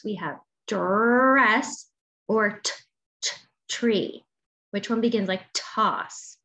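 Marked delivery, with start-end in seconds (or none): 3.2-4.2 t, t, tree with the t split off first; sounding out t. Shown as a demonstration.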